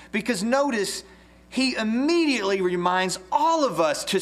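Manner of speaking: preacher urging with stretched key words; emphatic preaching. Real, not acted. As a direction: drive it home to the whole congregation. Preaches with raised fervour.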